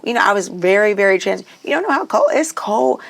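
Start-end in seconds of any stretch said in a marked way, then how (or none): 1.6-3.0 high, stressed warning about the cold; mock warning. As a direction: counselor to student, pitch rising high on cold.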